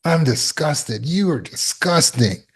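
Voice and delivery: high, slow, drawn-out contempt; acted self-disgust. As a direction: sneer at yourself in mock disgust. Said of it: "instead of fear-based self-discipline".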